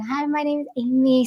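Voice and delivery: a hint of a small child introducing herself; child character voice. Shown as a demonstration. Demonstrating her child voice to the interviewer, a hint of a child. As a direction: lightly play a young girl saying hello. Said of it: "if I'm doing a child character".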